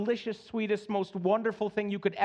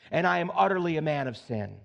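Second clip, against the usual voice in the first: usual speaking voice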